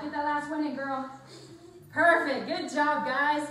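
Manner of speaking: shouted encouragement over music; loud cheering. Real, not acted. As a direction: cheer them through the last rep. Trainer cheering final reps.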